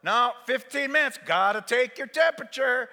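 loud, high, insistent orders; acted pushy home nurse; bossy nurse. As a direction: order the patient around. Acted bossy nursing wife.

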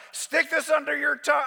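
loud, high command; acted insistent shout; bossy order. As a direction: order it like a pushy nurse. Acted wife ordering patient.

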